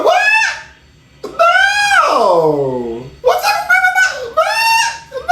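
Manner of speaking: high, shrieking mock fans; hammed-up screaming fans. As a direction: shriek like a crowd losing it.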